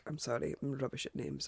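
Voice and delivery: ordinary delivery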